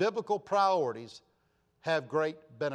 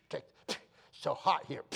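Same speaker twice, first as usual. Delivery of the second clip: mock disgusted grumble with spits; spitting disgust. Unhappy visitor complaining to his host, spitting in disgust. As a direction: imitate a disgruntled guest spitting between words. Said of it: "I know I spit".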